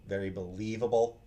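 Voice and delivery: ordinary delivery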